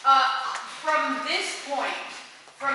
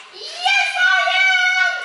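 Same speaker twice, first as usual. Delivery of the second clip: much higher, loud shout; emphatic shout; 8 dB louder, slower than usual. Shown as a demonstration. Director shouting the answer to make the point. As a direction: yell it with total conviction. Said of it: "if I haven't made this clear".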